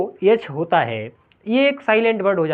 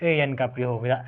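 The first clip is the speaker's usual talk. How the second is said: ordinary delivery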